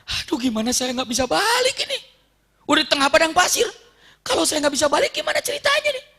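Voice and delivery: high-pitched mock panic; acted fear. Suraqah fears being stuck in the desert, hammed-up fear. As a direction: wail it like a stranded man fretting. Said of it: "Mulai ketakutan".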